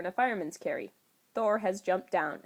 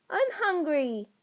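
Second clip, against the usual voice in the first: put-on higher, slow little kid voice; small child; 9 semitones higher, 3 dB louder, slower than usual. Clint tugging Tony's shirt, higher, slow toddler whine.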